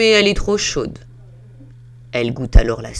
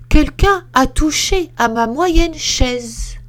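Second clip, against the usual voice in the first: higher, louder and slow mama bear; a fussy middle bear, pitched up; 9 semitones higher, 5 dB louder than usual. Acted. Middle bear complains about her chair, high and slow. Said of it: "dit d'une moyenne voix".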